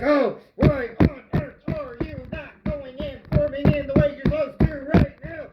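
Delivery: high-pitched, hammed-up tantrum; mock mad rant. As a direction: rant at the dough in comic fury. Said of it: "start getting madder and madder".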